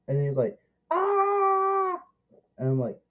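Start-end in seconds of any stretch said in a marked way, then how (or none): 0.9-2.0 a short, much higher imitated scream; mock scream. Imitating Kevin screaming in the movie, high mock yell. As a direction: yelp it like the boy in the film. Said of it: "he was screaming".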